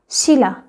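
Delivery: "शीला" with an l in place of n and r; assimilated pronunciation. Shown as a demonstration. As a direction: model "शीला" as one word. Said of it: "नहीं बोलना इसको".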